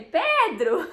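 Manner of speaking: put-on amused, tender call of the child's name; gentle laughing call. Shown as a demonstration. Mother calling her little son off the sofa, laughing. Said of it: "Se eu falar assim com ele".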